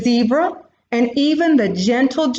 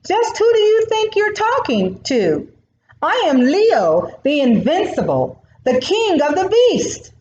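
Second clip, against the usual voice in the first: higher, outraged royal bluster; indignant lion king; 8 semitones higher than usual. Acted. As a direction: puff up and demand respect.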